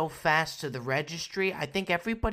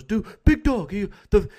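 high, louder hammed-up drunk buddy; mock a wasted friend calling from a party; 5 semitones higher, 8 dB louder than usual. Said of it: "He goes".

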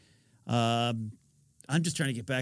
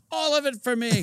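greedy glee; 11 semitones higher than usual. Retold or imitated. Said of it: "When's your friend coming?".

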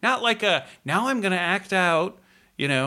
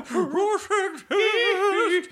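mock announcer echo; 13 semitones higher, much slower than usual. Mocking the dramatic announcer.

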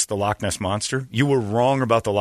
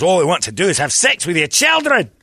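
higher-pitched ranting accusation; hammed-up indignant tirade; mock outrage; 6 semitones higher, 4 dB louder than usual. Imitating one sect railing at another, high mock outrage. Acted sectarian rant.

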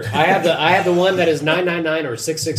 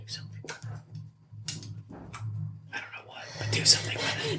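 urgent whisper; 14 dB quieter than usual. Real, not acted. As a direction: hiss it so only he hears. Urging a friend on the sly.

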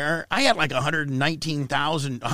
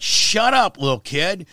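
retold yell; 3 semitones higher, 6 dB louder than usual. Taunting the teen activist on his radio show, loud yell. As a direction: shout her down on the air. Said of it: "And I'd just be like".

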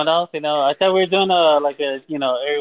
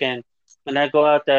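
ordinary talk, nothing marked

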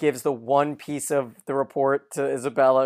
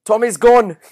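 higher, louder retold shout; imitated yell; 9 semitones higher, 10 dB louder than usual. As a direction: call the news out across the room. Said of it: "and he yells".

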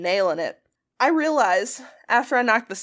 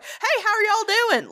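high, slow, hammed-up Southern drawl; worst accent; 10 semitones higher than usual. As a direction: ham up a terrible Southern accent. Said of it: "literally the worst accent I can come up with".

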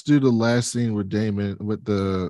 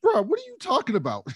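incredulous bro voice. Retold or imitated. Retold Laenor reaction.

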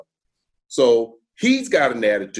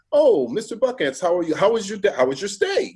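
higher, sing-song fake-friendly front desk voice; chirpy hotel staff; 7 semitones higher than usual. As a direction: sweet-talk the guest like a clerk. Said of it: "staff people saying".

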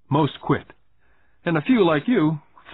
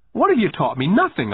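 put-on higher, louder boy, angry retort; 7 semitones higher, 4 dB louder than usual. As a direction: snap back like a cross child. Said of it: "I said angrily".

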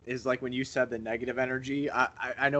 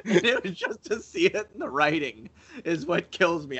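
laughing; 6 semitones higher than usual. Real, not acted. Cohost laughing about friend's miserable reaction. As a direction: crack up as you say it. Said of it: "he just looked so fucking miserable".

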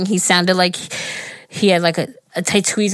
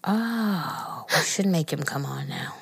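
whisper; 2 semitones lower, 11 dB quieter, slower than usual. Real, not acted. Conspiring aside.

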